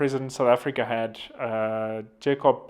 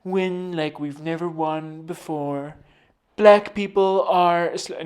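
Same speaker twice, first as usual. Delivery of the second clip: slow, higher Trump voice; Trump impression; 4 semitones higher, 4 dB louder, slower than usual. Mocking Trump's repetitive speeches, slow and drawn. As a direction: stretch every word like Trump at a rally. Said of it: "he thinks of what to say next".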